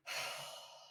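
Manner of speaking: a heavy, exasperated sigh out; audible huffy sigh; frustrated sigh. Shown as a demonstration. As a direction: let the breath go in frustration. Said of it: "and you sigh out of frustration".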